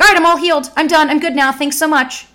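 high, loud, breezy mock triumph; hammed-up cheerful I'm-fixed voice; mock all-healed cheer. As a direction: toss it off like you're cured for good. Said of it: "going back and being like".

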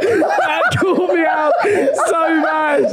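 real embarrassed laughing. Real, not acted. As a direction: laugh through the shock of being caught. Caught out on the call.

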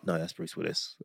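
ordinary talk, nothing marked

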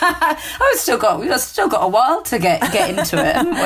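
laughing as the words come out, pitched high at first; amused, breaking into laughter. Real, not acted. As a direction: talk through a real laugh. Host laughs at co-host's quip.

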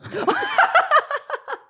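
high-pitched burst of laughter; laughing. Real, not acted. Storyteller finishing his fight anecdote, high laughter. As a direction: crack up at your own punchline.

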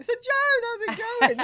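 high, loud, gushing fangirl voice; gushing fan. Retold or imitated. Teasing herself, a high loud gushing fan to [name]. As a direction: squeal it like an overexcited fan.

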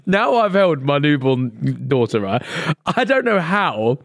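ordinary delivery

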